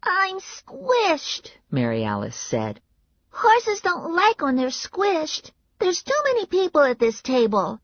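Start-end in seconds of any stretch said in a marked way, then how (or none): 0.1-1.5 put-on very high child voice; high-pitched little-kid complaint; complaining little girl. A narrator's reading. 3.3-7.8 put-on very high child voice; high-pitched little-kid complaint; complaining little girl. A narrator's reading.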